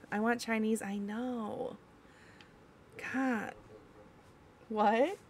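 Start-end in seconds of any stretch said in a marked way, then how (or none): none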